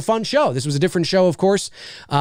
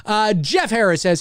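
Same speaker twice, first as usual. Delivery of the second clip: normal talking voice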